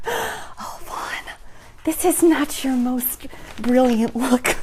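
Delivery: soft, slow motherly reproach; hushed, amused pity for a child; gentle chiding. Retold or imitated. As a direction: say it slowly and softly, mock-disappointed. Mother gently chiding in retold quote.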